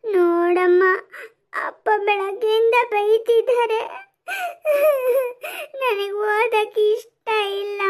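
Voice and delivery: whimpering daughter, words dragged through sobs; acted tearful pleading. Daughter complains to mother about father's scolding, crying. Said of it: "ಅಳ್ಬೇಡ ಕಂದ".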